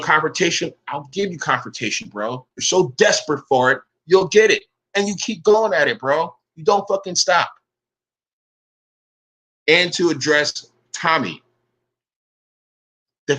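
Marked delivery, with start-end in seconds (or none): none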